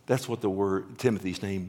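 normal talking voice

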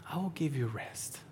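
whispered gentle promise. Retold or imitated. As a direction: whisper it like God comforting. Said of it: "the Lord's like".

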